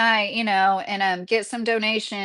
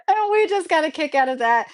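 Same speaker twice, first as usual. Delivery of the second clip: talking while still laughing; amused, words breaking with laughter; real laughter carrying the line; laughing through it; 8 semitones higher than usual. Real, not acted. Still laughing at neighbor story.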